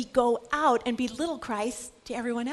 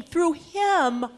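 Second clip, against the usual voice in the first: usual speaking voice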